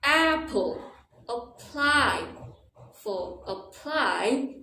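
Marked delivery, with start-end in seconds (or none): 0.0-0.8 APPLE with a high, long AP, falling away; stress on first syllable. Shown as a demonstration. Teacher demonstrating word stress, AP punched in apple. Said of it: "very different". 1.3-2.2 APPLY as quick uh, then high long PLY; stress on second syllable. Shown as a demonstration. Teacher contrasting two words, apply with stressed PLY. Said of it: "the A sounds like a".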